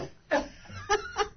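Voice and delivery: high teary laugh; embarrassed giggle; real laugh. Real, not acted. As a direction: laugh through the tears. Laughing through tears.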